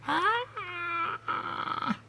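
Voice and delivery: a hammed-up open-mouthed cry; mock scream. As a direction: let out a high mock shriek. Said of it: "open his mouth like".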